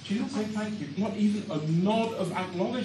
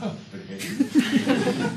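silly boast; 7 semitones higher, 4 dB louder, much slower than usual. Acted. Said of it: "I said".

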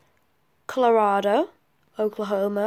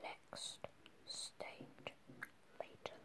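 breathy unvoiced whisper; 27 dB quieter, slower than usual. Real, not acted. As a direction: whisper it as a secret aside. Whispered aside to self.

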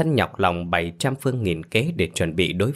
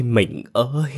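higher, drawn-out grieving call; high wailing cry; 4 semitones higher, slower than usual. A narrator's reading. Husband's final call to his dead wife, high drawn-out wail. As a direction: let the last call break upward in grief. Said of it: "Ông Lập đau đớn gào khóc".

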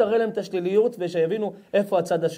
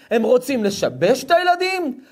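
normal talking voice

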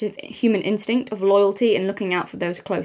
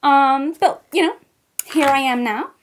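mock American accent as Kendall Jenner, valley-girl vibe; terrible American accent; 5 semitones higher, slower than usual. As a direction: do a hammed-up American model voice. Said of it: "my terrible American accent".

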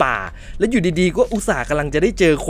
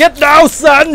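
loud barked order to a son; gruff dad order; 9 semitones higher, 10 dB louder, much slower than usual. Retold or imitated. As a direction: bark like a soldier dad. Echoes the father character's line. (ตะโกนสั่งแบบพ่อ)

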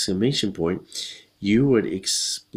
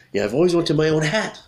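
higher-pitched, eager holler; mock holler, pitched up; excited holler; 6 semitones higher than usual. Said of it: "hollers Lou".

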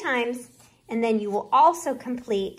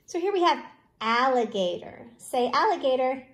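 usual speaking voice

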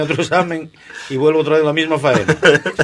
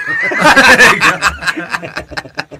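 real burst of high laughter; hearty laugh; 9 semitones higher, 5 dB louder than usual. Real, not acted. Teller laughing at his own setup, hearty laugh. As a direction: laugh out loud before the story starts.

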